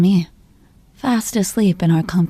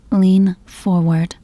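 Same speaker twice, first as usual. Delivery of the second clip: slow command; much slower than usual. Acted.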